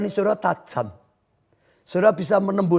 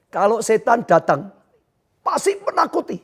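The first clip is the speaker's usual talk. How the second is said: ordinary delivery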